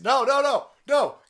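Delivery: high frantic no's; hammed-up panic; mock panicked agent. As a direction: yelp it like a panicking bodyguard. Acting panicking security agent.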